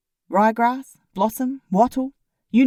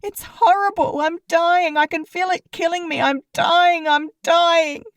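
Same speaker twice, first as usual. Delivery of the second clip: panicked dying; 8 semitones higher, 4 dB louder than usual. A narrator's reading. Pip hammily acting a death.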